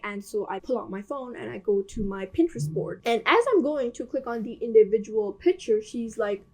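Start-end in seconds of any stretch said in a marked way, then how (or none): none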